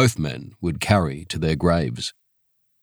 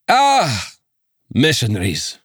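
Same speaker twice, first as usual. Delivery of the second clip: a Scots official recognising missionaries; Scots magistrate; 4 semitones higher than usual. Acted. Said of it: "The accent was thick Scots".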